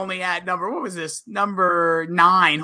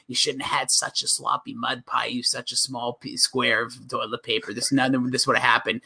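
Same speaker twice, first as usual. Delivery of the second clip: hushed scolding quote; 8 semitones lower, 7 dB quieter than usual. Retold or imitated. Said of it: "he's just like".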